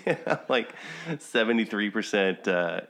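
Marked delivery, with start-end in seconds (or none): 0.0-0.7 laughing through the words, pitched up. Real, not acted. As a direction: giggle out the punchline. Laughing while telling DNA result.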